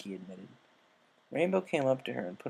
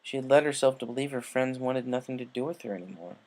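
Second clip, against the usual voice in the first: usual speaking voice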